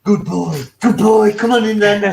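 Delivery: loud praise to a dog coming indoors; calling the dog in. Retold or imitated. Neighbours getting their German Shepherd inside, calling out. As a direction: call out heartily to the dog. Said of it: "shouting the dog in".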